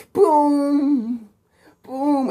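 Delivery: slow, high booms; the plant explodes; explosion sounds. A narrator's reading. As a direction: make big boom noises. Imitating explosion.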